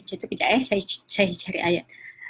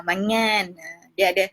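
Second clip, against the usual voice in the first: usual speaking voice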